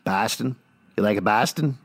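mock Boston accent, pitched a bit low; badly faked Boston accent. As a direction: put on a rough Boston accent. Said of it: "How's my accent work".

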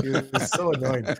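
ordinary talk

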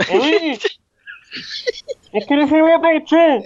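prank caller voice. Pretend. Fake caller on line.

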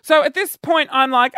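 usual speaking voice

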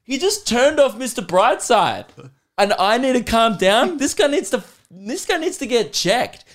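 retold fury at the bar staff guy, pitched high; mock outraged rant. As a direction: rant like you can't believe it. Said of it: "I was like".

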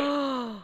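sharp, excited intake of breath; delighted gasp. Real, not acted. Host thrilled about a favourite cooking account, delighted gasp.

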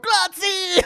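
very high-pitched mock yell; shouting tourist. Tourists at an Italian soccer game yelling a word they know, high mock shout. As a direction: imitate a clueless tourist cheering in bad Italian. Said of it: "dickhead tourists shouting out".

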